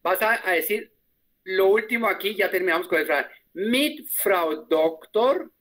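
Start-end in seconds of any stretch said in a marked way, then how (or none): 3.5-5.5 "Mit Frau Doktor" muy despacio, subiendo al final; modelo lento. Shown as a demonstration. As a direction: lo dicta para que lo repitan. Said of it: "repite la alumna". (despacio y subiendo al final)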